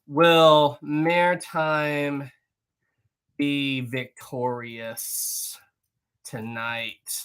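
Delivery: very slow, with long gaps between phrases; drawn-out typing pace. Real, not acted. Streamer typing a poll for the chat, very slow. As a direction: say it piece by piece while typing.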